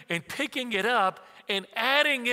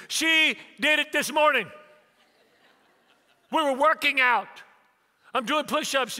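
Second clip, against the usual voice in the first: high, loud indignant retelling; outraged son; 7 semitones higher, 6 dB louder than usual. Retold or imitated. Son tells dad about the workout, high and outraged. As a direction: imitate a son complaining at full pitch.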